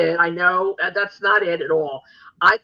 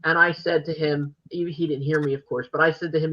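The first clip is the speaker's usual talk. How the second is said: usual speaking voice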